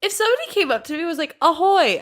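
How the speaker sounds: high, fast incredulous; excited. Real, not acted. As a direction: rush it out in disbelief.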